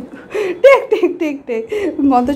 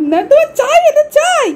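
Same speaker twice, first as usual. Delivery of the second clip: mock whine; 12 semitones higher, 5 dB louder than usual. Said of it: "দেখো কাঁদছে".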